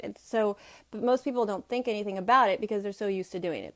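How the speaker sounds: normal talking voice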